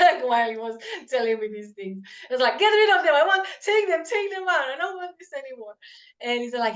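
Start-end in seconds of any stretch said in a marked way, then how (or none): 2.6-5.7 high, loud frantic begging to be rid of them; panicked plea. Retold or imitated. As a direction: relive the panic, demanding they go. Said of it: "I was freaking out".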